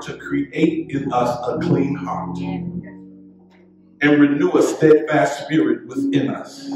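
usual speaking voice

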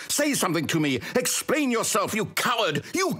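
rising, indignant voice; angry scolding. Acted. Narrator scolding player.

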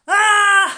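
very high, loud scream of laughter; shrieking laugh. A narrator's reading. The animals laughing at the wobbegong, shrieking laugh. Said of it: "laughed all the other animals".

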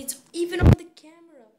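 fast, loud, high outburst; shocked shout. Real, not acted.

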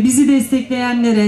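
normal talking voice

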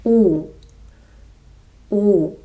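у with rounded lips, like oo in moon; demonstrated vowel. Shown as a demonstration. Teacher shows the vowel у, lips rounded. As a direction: hold у with lips pushed forward. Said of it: "the next vowel is".

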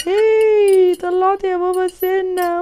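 high, loud, joyful shout; triumphant cheer. Acted. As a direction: celebrate like a proud kid. Tooth finally out. (فرحة طفل بطلوع سنته)

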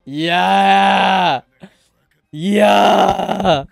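long held cheer; real drawn-out holler. Real, not acted. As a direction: hold the yell out. Cheering on the fight.

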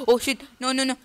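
gasp, then a loud high oh no; startled gasp. Real, not acted. Gamer startled by the enemy jumping in, gasping. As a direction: caught off guard, blurting it out. Sudden scare from enemy player.

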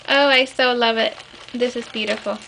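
ordinary delivery

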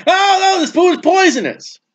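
high-pitched mock panic at the table; alarmed cry. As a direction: sound the sudden alarm of finding poisoned food. Imagined alarm at holiday table.

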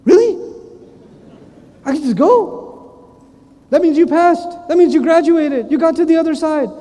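can't believe he's let through; surprised delight. Retold or imitated. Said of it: "You're like".

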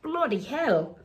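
put-on Cockney accent. Accent slipped from Milan to Cockney, Cockney accent. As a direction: jump up into a hammy Cockney. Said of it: "Now Cockney".

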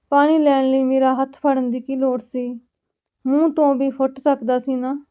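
usual speaking voice

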